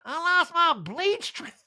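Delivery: very high, slow, breaking into laughs; Erica impression, laughing. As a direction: stretch it out through giggles.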